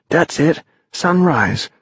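put-on high, delighted boy voice; excited boy voice. A narrator's reading. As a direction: announce it like a thrilled kid. Tom's voice in story.

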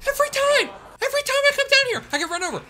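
very high, loud frustrated shriek; exasperated yell. Real, not acted. Streamer after being run over, high exasperated yell. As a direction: howl at the unfair game.

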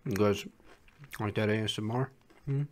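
talking while chewing chip; crunchy chewing close to mic; mouth full, chewing. Real, not acted. Seen 0: Ate chip at -7; jaw moves, leaning toward the mic.

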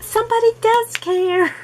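high, happy squeal of relief; delighted inner thought. Retold or imitated. To herself, greeted by jumping dogs: high delighted squeal. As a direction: gush it like the dogs just proved they love you. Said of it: "You're like".

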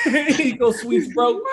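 laughing it out slowly, high and loud; drawn-out laughing read. Real, not acted. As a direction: crack up while reading the name.

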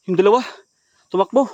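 high, very loud cry; alarmed shout. Real, not acted. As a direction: shout out that they are escaping. Shouts as two run off. (pasigaw sa gulat)

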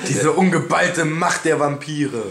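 mock epic.

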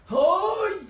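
high, sharp cry of pain. Real, not acted. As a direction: yelp from the burn. Pain mid exercise.

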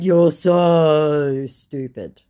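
loud, very slow put-down; drawn-out insult. Retold or imitated. Woman insulting the scammer, slowly scornful. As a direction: stretch the word "so" right out.

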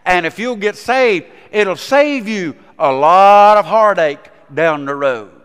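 full-force revival preaching; fiery preaching. Acted. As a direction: preach it out with fervor. Preacher exhorting listeners at full force.

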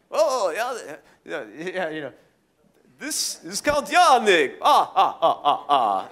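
high, loud cartoon count with staccato laugh; Count Yannick. Promoter introducing himself in character, hammy count voice. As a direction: ham up a vampire-style count, cackling. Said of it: "I've done promotions as, as Count Yannick".

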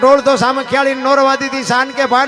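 rhythmic poem recitation. Acted. Storyteller reciting the Bhuj earthquake poem to the crowd, loud and chanted. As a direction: declaim each place name like a rolling folk chant.

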